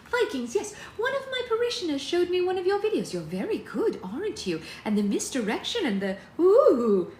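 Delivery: high, fluting recognition; gushing mother. A narrator's reading. Mother recognising Alex from his videos, high gushing. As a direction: gush high, swelling to a loud ooh.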